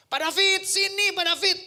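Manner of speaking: loud high call across the room. Retold or imitated. Pak Haji calling David from the bedroom, loud high call. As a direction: shout for him from the other room. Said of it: "Pak Haji itu teriak".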